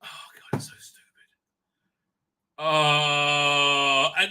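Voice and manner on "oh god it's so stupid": whispered, exasperated aside; whispered disgust. Real, not acted. Host groaning about the episode to himself, whispered. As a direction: breathe it out under your breath in disgust.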